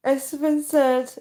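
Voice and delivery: normal talking voice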